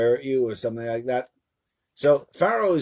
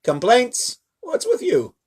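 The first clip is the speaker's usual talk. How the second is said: raised pitch, loud, mock-exasperated scolding; exasperated God; 10 semitones higher, 5 dB louder than usual. God scolds Moshe for complaining, high exasperated rant. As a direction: throw up your hands at the complainer. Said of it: "Rashi says".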